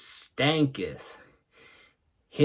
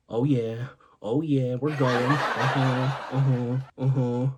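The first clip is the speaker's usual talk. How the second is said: easygoing groove; 2 semitones lower than usual. Retold or imitated. Acts cruising happily in car.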